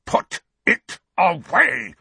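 slow, loud, high angry snarl; furious, forceful hiss of a bullying uncle. A narrator's reading. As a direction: snarl each word as an order. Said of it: "Uncle Vernon snarled into Harry's ear".

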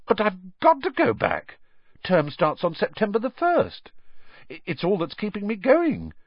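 lightly voiced boy, pleading; a hint of a desperate boy; boy Harry.

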